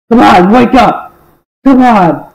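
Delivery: loud urgent call to wake him up; calling to wake someone. Acted. Rousing a knocked-out friend, loud urgent call. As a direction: shout him awake, twice.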